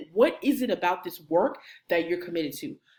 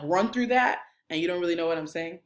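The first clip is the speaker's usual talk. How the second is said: ordinary delivery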